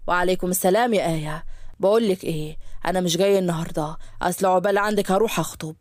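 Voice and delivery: put-on low, breezy young man on the phone; a doctor bragging to his secretary; Yusuf's voice.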